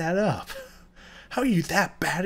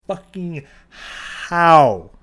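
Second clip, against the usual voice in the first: loud, very slow outraged shout; drawn-out angry shout; 4 semitones higher, 8 dB louder, much slower than usual. Real, not acted. Demanding an answer from negligent doctors, slow angry shout. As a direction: stretch it out in pure outrage. Ranting about anesthesia errors.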